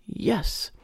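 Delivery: plain reading voice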